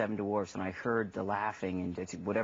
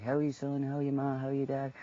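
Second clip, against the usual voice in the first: lifted, higher-pitched Irish lilt; Irish accent; 5 semitones higher than usual. Shown as a demonstration. Demonstrating phone chats with mom and dad, in Irish accent. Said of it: "Can you still do the Irish accent?".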